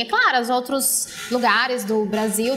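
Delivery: ordinary delivery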